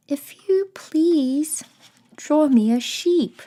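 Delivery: put-on high, loud little child-like story voice; drawn-out, high-pitched little request; odd little voice. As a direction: pipe up politely in a small, high voice. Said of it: "awakened by an odd little voice".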